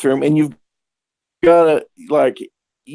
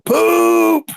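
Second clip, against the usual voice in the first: high shouted poop; man yelling from the bedroom, high shout; yelled out. Retold or imitated. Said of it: "he'd start going".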